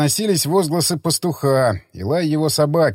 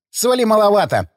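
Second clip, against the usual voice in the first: shouted demand; 7 semitones higher than usual. A narrator's reading. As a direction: shout it across the table, chewing. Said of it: "крикнул Ходокири".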